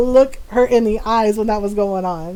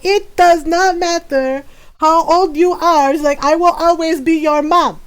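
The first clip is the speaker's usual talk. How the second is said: imitated mother's emphatic telling-off; scolding mom; 3 semitones higher than usual. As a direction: lay down the law as an indignant mom. Mother scolding while whooping.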